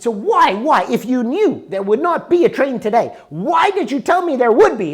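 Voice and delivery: retold outburst at the clerk; angry. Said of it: "Now I'm getting really angry".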